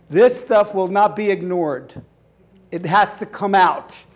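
usual speaking voice

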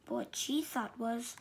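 ordinary delivery

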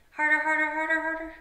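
high, loud, rapid-fire urging; strained, high-pitched cheerleading; urgent egging on. Shown as a demonstration. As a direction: push them to squeeze with all their might. Urging kids to tense their muscles.

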